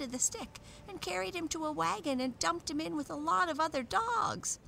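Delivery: high, excitable talking dog; agitated dog character. A narrator's reading. Peterkins telling the dolls of Fido's capture, high and agitated.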